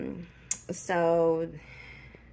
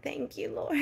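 emotional thanks; 7 semitones higher, 4 dB quieter than usual. Real, not acted. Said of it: "I didn't mean to get emotional".

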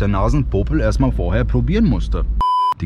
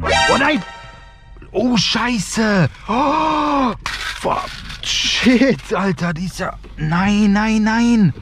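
panicked; 9 semitones higher, much slower than usual. Real, not acted. Bean drops into dashboard.

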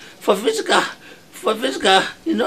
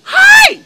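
loud, very high yelp of shock; piercing startled cry; shocked shriek; 10 dB louder than usual. Acted. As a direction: scream as if just struck. Jolted awake by a slap.

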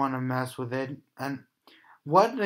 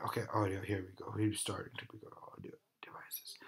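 whispering; 5 semitones lower, 13 dB quieter, much faster than usual. Real, not acted. Whispering while playing horror game.

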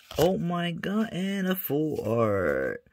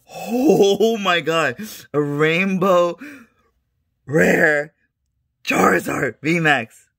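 awed excitement; 5 semitones higher, 6 dB louder than usual. Real, not acted. Sees rainbow rare again.

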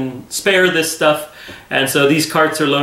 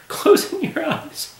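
a teary laugh breaking through, pitched much higher; emotional laugh; 12 semitones higher, 4 dB quieter, slower than usual. Real, not acted. Preacher moved by the prophecy, laughing through emotion. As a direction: let a moved laugh lift the words up high. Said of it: "I'm gonna get emotional tonight".

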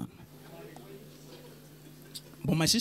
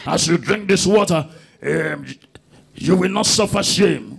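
louder, slow, preaching over the water; loud slow pastor prayer; 3 semitones lower, 7 dB louder, slower than usual. Retold or imitated. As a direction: proclaim each word like a pastor.

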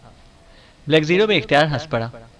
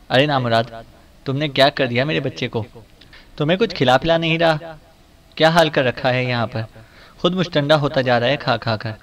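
ordinary delivery

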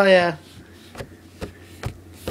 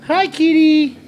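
much higher, louder pet talk; cooing at a kitten; 7 semitones higher, 6 dB louder than usual. Real, not acted. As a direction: call to the cat in a high sing-song. Greeting the kitten.

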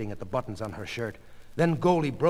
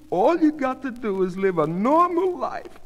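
high-pitched, insistent reassurance; coaxing wife; 13 semitones higher, 4 dB louder than usual. A narrator's reading. Goldie repeating the doctor's advice to her husband, coaxing. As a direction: echo the advice eagerly, pitched well up.

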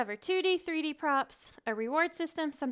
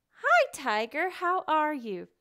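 higher, louder, slow greeting to the puppet; bright teacher greeting; 5 semitones higher, 8 dB louder, slower than usual. Shown as a demonstration. Teacher greets her pretend tiger puppet, lively and bright. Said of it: "Take a puppet and go".

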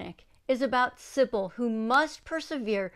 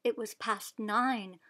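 ordinary delivery, nothing marked

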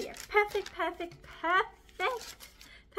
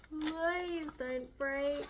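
pleading; much slower than usual. Real, not acted. Pleading with fragile piece.

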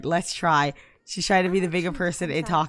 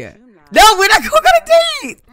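high, loud refusal in a put-on voice; wife refusing a date, shouted in a high voice; voicing angry Abigail; 14 dB louder, slower than usual. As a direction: shout her no as the furious wife.

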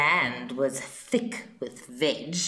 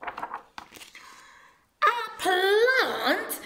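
high shocked shriek; hammed-up appalled dinosaur mum; horrified yell. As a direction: yell it in disgust like an outraged mum. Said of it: "yelled Mum in horror".

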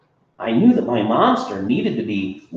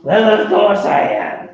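snapping; 10 semitones higher, 5 dB louder than usual. Acted. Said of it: "she snapped".

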